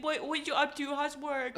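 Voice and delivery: put-on high, cooing baby talk, laughing; baby-talk a friend on the phone; cutesy baby voice. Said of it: "talk in a baby voice".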